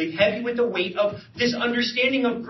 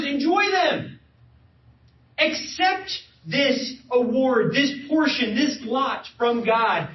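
higher, slow proclaiming of scripture; preaching scripture; 6 semitones higher than usual. Acted. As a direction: declaim the verse slowly and fervently. Reading Ecclesiastes aloud with force.

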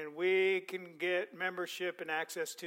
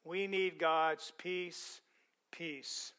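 slow and soft; 3 semitones lower, 5 dB quieter, much slower than usual. Shown as a demonstration. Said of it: "slow soft emphasis".

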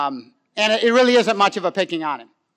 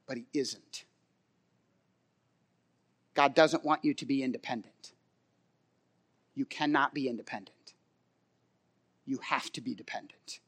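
very low, soft, near-whispered preaching; hushed gravity; 8 semitones lower, 14 dB quieter than usual. Real, not acted. Preacher telling believers they must depend on God, hushed. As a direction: drop right down and let each sentence stand alone. Preacher drops voice for key truth.

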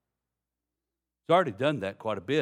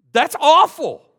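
much higher, much louder cry of disgust; appalled exclamation; 18 dB louder, slower than usual. Retold or imitated. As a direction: recoil in shocked disgust.

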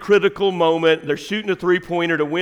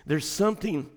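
much softer, intimate sermon delivery; quiet preaching; 8 dB quieter than usual. Acted. Preacher reading Jesus' words to the church, soft intimate preaching. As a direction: pull them in close and quiet.

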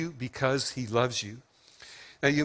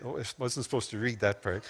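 quick, much softer aside, half to himself; embarrassed aside; 4 semitones lower, 7 dB quieter, faster than usual. Real, not acted. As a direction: hurry past it, sheepishly. Caught reading the card aloud.

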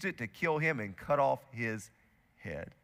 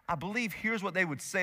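normal talking voice

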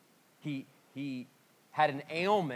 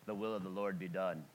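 lower, softer pious intoning; solemn Reformed friend; 6 semitones lower, 6 dB quieter than usual. Retold or imitated. Reformed friends visiting his sick dad, solemn and pious. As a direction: intone it like a grave churchman. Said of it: "they would come in and be like".

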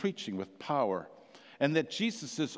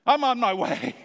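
high and loud, bursting out; high, loud, joyful burst; eager reply; 9 semitones higher, 7 dB louder than usual. Acted. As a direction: call out, suddenly eager. Woman eagerly answering Jesus.

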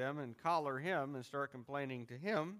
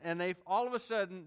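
ordinary talk

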